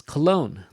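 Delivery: "cologne" with "logne" hit harder; stress on second syllable. Shown as a demonstration. Teacher showing students where the stress in "cologne" falls. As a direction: lean on the end of "cologne". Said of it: "capital for the strong one".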